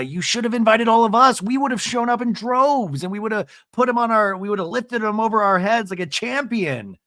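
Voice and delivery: eager retelling. Retold or imitated. Sister insisting they'd have shown up. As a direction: pitch it up like a sister rallying. Denise urging Lisa, retold.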